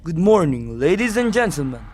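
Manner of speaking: ordinary talk, nothing marked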